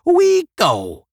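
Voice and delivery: very high, loud call; crying out. A narrator's reading. The eighteen announcing they leave, crying out. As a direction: cry out the decision. Group cries out its departure.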